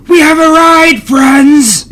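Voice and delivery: excited shout, high and loud. Acted. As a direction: yell it to the whole bar. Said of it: "with his mouth full of food".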